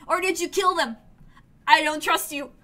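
loud suspicious challenge; accusing. Real, not acted. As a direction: throw the accusation at him. Player accuses commander.

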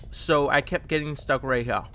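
usual speaking voice

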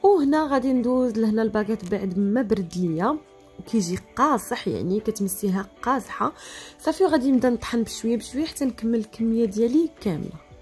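usual speaking voice

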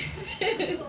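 soft flirty giggle; a little breathy giggle. Acted. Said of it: "she said".

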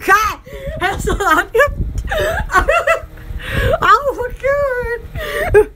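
high helpless laughter, slow words; laughing hard. Real, not acted. Laughing hard at her own story, high and breathless. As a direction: crack up and gasp out oh my God. Laughing fit after a joke.

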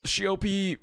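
high excited call. Real, not acted. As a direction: call the name brightly. Calling out to pet.

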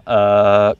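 "uh" held, then a crisp K; emphasized final K. Shown as a demonstration. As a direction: lean on the K that closes "uh". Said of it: "emphasize that K sound".

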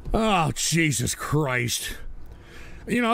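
ordinary delivery

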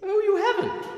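a very high, squealing mock astonishment; the cat pretending to be amazed; mock surprise. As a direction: act shocked, far too much. Cat amazed she hasn't met the Queen.

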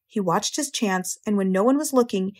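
usual speaking voice

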